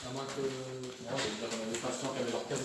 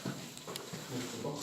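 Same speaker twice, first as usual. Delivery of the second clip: nervous night vlog whisper; 8 dB quieter, much slower than usual. Real, not acted. Nervous urbex exploration in dark building.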